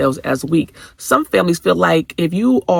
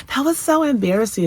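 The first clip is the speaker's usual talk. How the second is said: mimicking teenage daughter; 11 semitones higher than usual. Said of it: "she was talking about".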